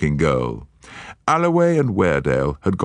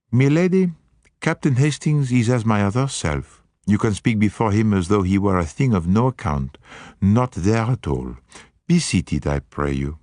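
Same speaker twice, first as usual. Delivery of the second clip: put-on higher, gallant Belgian accent; courtly Poirot; 5 semitones higher than usual. Poirot seating Lady Juliet, courtly Belgian accent. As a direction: welcome her with polished courtesy.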